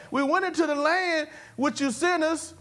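excuse-making tone. Acted. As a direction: put on a high excuse-maker's tone. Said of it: "Only ten were talking like this".

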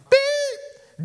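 one loud, high bleep; imitated censor beep. Preacher censoring Peter's cursing, high mock bleep.

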